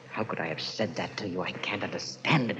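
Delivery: quiet, low anguish; ashamed, barely above a murmur; remorseful. Acted. Remorseful over last night.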